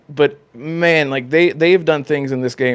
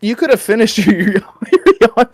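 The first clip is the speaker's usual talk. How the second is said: laughing retort; 6 semitones higher, 4 dB louder than usual. Retold or imitated. Player reply to yawning NPC.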